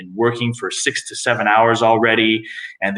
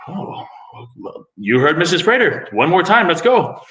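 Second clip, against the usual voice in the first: rallying call; 9 semitones higher than usual. Retold or imitated. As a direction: fire up the students, playfully. Calling out to the students.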